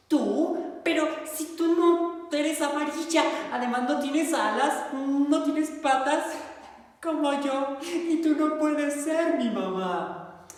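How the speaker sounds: high, small plaintive duckling; a sad little duck, pitched well up. Acted. Choco tells the bear she has no mother, high and plaintive. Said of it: "duckling protests".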